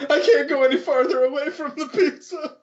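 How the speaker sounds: high-pitched mocking wail; mock whimpering. Player parodies the crying man over pizza, mock whimpering. Mimics the crying man's plea.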